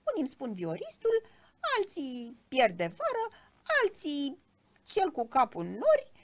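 high, loud little creature reeling off a list; squeaky self-important cricket. A narrator's reading. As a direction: list the nicknames as a put-out tiny fiddler. (greieraș pițigăiat, plin de sine)

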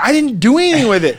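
high-pitched protesting mom; mock defensive mom. Mom denying it to her yelling son, high mock protest. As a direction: imitate mom's denial, pitch way up. Mom answering in retold quarrel.

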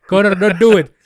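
high, loud imitated shout; mock panicked yell. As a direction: yell it like a boss losing control. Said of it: "Dana huutaa". (Dana huutaa Conorille: kova, korkea huuto)

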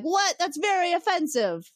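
high-pitched mock indignation; offended Ferengi. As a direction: squeal it up as an affronted alien. Ferengi acts offended at insult.

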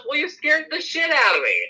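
high-pitched indignant imitation; retold scared complaint. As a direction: act out a rattled friend complaining. Acting out the scared friend's complaint.

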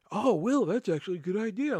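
a hint of a sing-song imitated coworker; mock coworker. Coworkers shown the tidied stock, lightly mocked.

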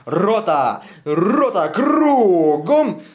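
loud parade-ground command; officer turning the company around, loud command; distant army command. Acted. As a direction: bark the about-turn. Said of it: "послышался глухо далеко голос".

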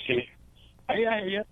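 ordinary delivery

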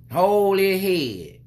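slow, high, drawn-out order to hold the head; bossy lady. Retold or imitated. Imitating a stranger scolding him about his son. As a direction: mimic the pushy lady, stretching each word. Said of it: "the lady looking like".

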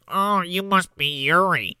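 slow, mock pinched-nose talk. Simon grabbed by the nose greets Yuri, pinched and slow. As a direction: talk as if your nose is held. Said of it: "grabs Simon by the nose".

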